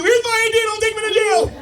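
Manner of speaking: very high, loud pleading squeal; mock panic. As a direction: squeal it out in hammed-up terror. Cyclist panics at jail threat.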